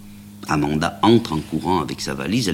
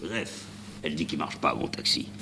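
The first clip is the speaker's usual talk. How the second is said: bien plus bas et doux, ruminé; marmonné pour soi; 10 dB quieter than usual. Acted. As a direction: ronchonner dans sa barbe. Répète pour lui-même, rancunier. (le chauffeur rumine la remarque d'Amanda, voix basse)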